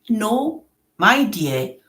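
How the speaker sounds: ordinary talk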